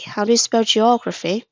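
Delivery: ordinary talk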